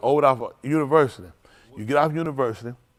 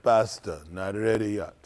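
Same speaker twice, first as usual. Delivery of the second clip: lightly voiced Jamaican man; low cook voice; 9 semitones lower, 6 dB quieter than usual. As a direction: drop into the cook's voice.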